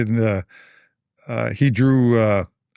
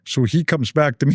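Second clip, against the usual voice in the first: usual speaking voice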